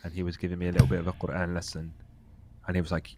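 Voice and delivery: ordinary delivery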